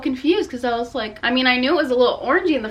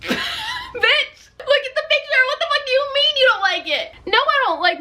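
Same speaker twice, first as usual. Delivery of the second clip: much higher, laughing disbelief at the stylist; incredulous shriek. Retold or imitated. Answering the stylist who asks if she dislikes it, shrieking in disbelief. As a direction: shriek it as if the answer were obvious. Outraged retort to stylist's question.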